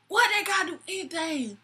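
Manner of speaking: high-pitched challenge, imitating a drunk; mock indignant drunk. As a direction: push back as the drunk customer. Drunk date challenges the manager.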